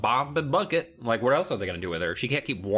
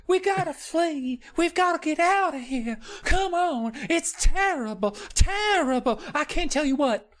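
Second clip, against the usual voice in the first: put-on panicked little girl. Alicia pulling Wendy away, high panicky child voice. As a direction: plead like a scared kid tugging at you. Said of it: "And Alicia's just like".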